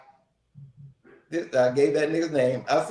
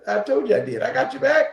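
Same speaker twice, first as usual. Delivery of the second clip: proud retold boast, pitched up and louder; high excited boast; 9 semitones higher, 4 dB louder than usual. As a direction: crow about it to her, laughing inside.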